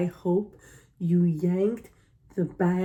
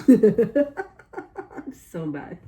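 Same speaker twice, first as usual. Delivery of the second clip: laughing through "I'm so bad", slow and stretched out; a guilty giggle at her own joke; 4 semitones higher, 5 dB louder, much slower than usual. Real, not acted. Owning up to her harsh comment, laughing.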